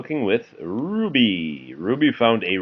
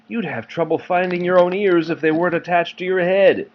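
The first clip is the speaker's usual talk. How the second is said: higher, fast bossy sister; Ruby teasing Max; 9 semitones higher, 3 dB louder, faster than usual. Acted. Parent reads Ruby mocking Max, higher and fast. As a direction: rattle off the tease like a know-it-all. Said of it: "said Ruby".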